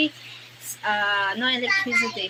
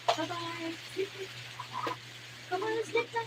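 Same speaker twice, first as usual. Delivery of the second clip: higher, softer sing-song coaxing; 9 semitones higher, 11 dB quieter, faster than usual. Real, not acted. Mother cooing goodnight to her baby, high and soft.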